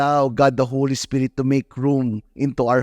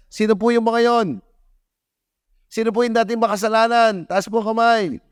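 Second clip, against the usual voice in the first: calling out to the congregation; 8 semitones higher than usual. Real, not acted. Preacher calls on congregation.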